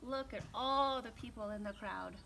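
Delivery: ordinary delivery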